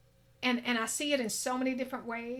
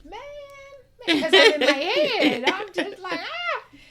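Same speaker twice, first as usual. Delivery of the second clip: loud, high laughing admission; laughing loudly about being stuck in her head; 10 semitones higher, 15 dB louder than usual. Real, not acted. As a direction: laugh through it at yourself. Laughing at her own impatience.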